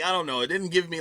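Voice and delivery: ordinary delivery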